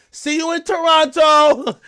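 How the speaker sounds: high, loud, slow sing-song farewell. Retold or imitated. Imitating [name] teasing [name] on the flight, high and drawn out. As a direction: drawl it out high like a teasing goodbye. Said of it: "she on the flight with [name] like".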